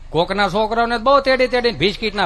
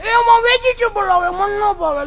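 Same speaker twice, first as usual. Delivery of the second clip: put-on whiny child; 11 semitones higher, 3 dB louder than usual. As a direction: whine it out like a spoiled kid. Child refusing. (જિદ્દી બાળકનો ઊંચો અવાજ)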